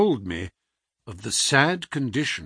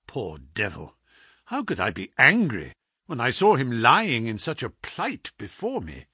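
usual speaking voice

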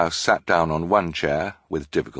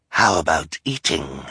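sly villain; 3 semitones lower than usual. Acted. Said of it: "Count Olaf said, his eyes shining".

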